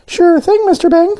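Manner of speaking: squeaky high cartoon voice; high donut voice. A narrator's reading. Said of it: "answered Arnie".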